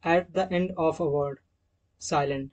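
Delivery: at the end of a word read slowly and deliberately; slow dictated rule. Shown as a demonstration. Teacher reading a rule slowly for students to note down. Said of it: "पहला rule".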